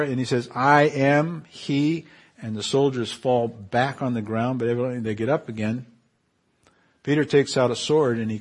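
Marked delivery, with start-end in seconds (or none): 0.5-2.1 slow, weighty declaration; solemn Jesus. Acted. Jesus answering the soldiers who came for him, slow and solemn. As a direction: let each word land.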